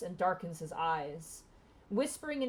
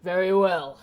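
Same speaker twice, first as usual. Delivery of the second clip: hobgoblin guard; 8 dB louder than usual. Acted. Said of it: "the other hobgoblin kinda looks down and goes".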